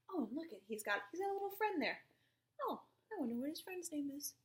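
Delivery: plain reading voice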